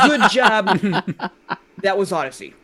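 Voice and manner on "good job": loud over-the-top praise through a laugh; hammed-up game-show praise; mock congratulation. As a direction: cheer it far too hard.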